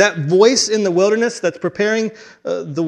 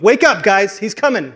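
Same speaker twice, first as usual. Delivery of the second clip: higher, louder urgent wake-up call; 5 semitones higher, 5 dB louder than usual. Acted. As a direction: rouse sleepers with an urgent shout. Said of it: "messenger that's gonna wake you up".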